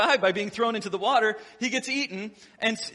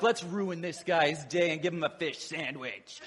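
lower, scheming aside from the fast food crew; scheming restaurant staff; 4 semitones lower, 4 dB quieter than usual. Retold or imitated. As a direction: plot their prank in a lightly put-on conspiratorial voice. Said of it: "They're like".

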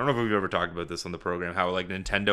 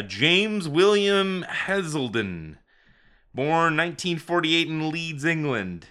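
mock formal read; 5 semitones higher, 3 dB louder than usual. Reads Wikipedia entry aloud.

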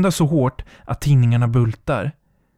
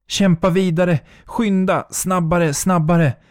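higher, pushing words; high desperate push; frantic urging; 5 semitones higher than usual. Acted. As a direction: drive yourself faster. Urging herself on.

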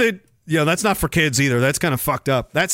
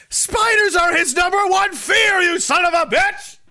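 high-pitched yelling; mock outraged shout; 10 semitones higher than usual. Host berating someone over dad's spider fear, mock outraged. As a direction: yell it high, in comic outrage.

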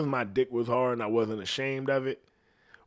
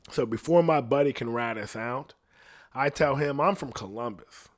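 ordinary talk

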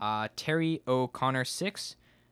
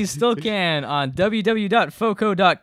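raised, higher sponsor pitch; loud ad read; 6 semitones higher, 8 dB louder than usual. Acted. Host urging listeners to buy bobbleheads, loud ad read. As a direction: push the web address like an advertiser.

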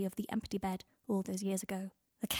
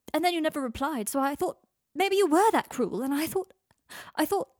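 distraught rant; 6 semitones higher, 4 dB louder than usual. A narrator's reading. Draco pouring out his distress.

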